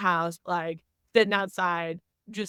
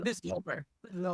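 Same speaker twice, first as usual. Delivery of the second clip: muttered resignation; 9 dB quieter, much faster than usual. Retold or imitated. Coach quietly writing off the game, softly resigned. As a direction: say it to yourself, done.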